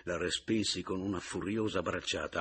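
usual speaking voice

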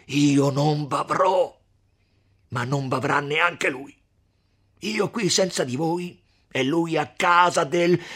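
loud, slow, bitter threat; desperate shout. Acted. Renzo shouting at Lucia in despair. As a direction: hurl the words at Lucia in despair. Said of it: "gridò Renzo".

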